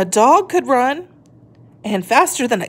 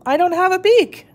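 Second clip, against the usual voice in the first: higher, plaintive little voice; small sad tortoise; 9 semitones higher than usual. Acted. Shelley realises she cannot break ice, plaintive and high. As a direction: admit it with a small disappointed voice.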